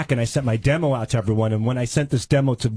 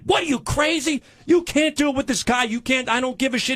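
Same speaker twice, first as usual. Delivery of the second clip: much higher, mock-outraged agent; agent scolding; 11 semitones higher than usual. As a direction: play the indignant agent cutting him down. Agent voiced in angry retold quarrel.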